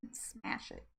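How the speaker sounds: breathy whispered joke; whispered aside. Pretend. Teasing viewers about smashing the like button, whispered aside. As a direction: drop to a whisper for the punchline.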